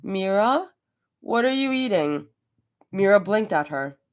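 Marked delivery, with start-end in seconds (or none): 0.0-2.3 petulant. A narrator's reading. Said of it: "Her voice came out low and petulant".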